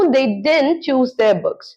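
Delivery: very slow, each word separate; slow model sentence. Shown as a demonstration. Teacher repeating didn't sentence, very slow. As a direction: repeat it slowly for the class. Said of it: "तो मैं इसको फिर बोलती हूँ".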